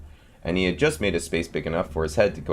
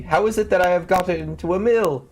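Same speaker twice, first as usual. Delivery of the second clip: high, louder little voice, puzzled; tiny boy confused; 11 semitones higher, 4 dB louder than usual. A narrator's reading. Tom Thumb inside the cow, high puzzled cry. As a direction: squeak out your confusion. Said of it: "cried he".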